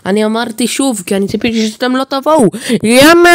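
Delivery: rising into a loud, high cry of why; frustrated outburst. Real, not acted. Gamer exasperated at the game, building to a loud cry. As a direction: build from complaint to exasperated yell.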